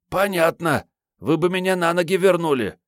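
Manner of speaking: put-on high girlish voice; young girl voice. A narrator's reading. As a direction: play a timid girl. Girl character voiced by narrator.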